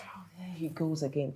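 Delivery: usual speaking voice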